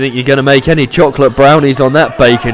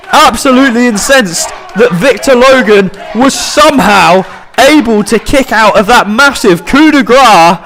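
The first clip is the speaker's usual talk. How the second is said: wrestling commentator; 11 semitones higher than usual. Acted. Live wrestling play-by-play.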